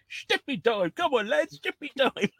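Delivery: high-pitched mock-Cockney rallying call; hammed-up Cockney sweep; Mary Poppins chimney sweep. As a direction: call the lads out like a musical sweep. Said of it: "You need to be in Mary Poppins".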